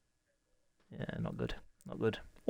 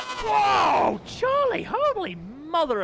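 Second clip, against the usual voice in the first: high, loud startled cry; shocked shout; 13 semitones higher, 4 dB louder, much slower than usual. Real, not acted. Commentator shocked as Charlie crashes, shouting. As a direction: yelp out at the sudden crash.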